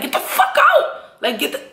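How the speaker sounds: high mock yell with a laugh; hammed-up shout, laughing through it; retold yell, half laughing. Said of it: "I'm not screaming like how Tommy was".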